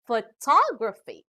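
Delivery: "photography" with a punch on TOG; stress before ending. Shown as a demonstration. Teacher showing ending graphy, "photography" stressed on TOG. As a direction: stress the second syllable of "photography". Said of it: "the syllable before these endings".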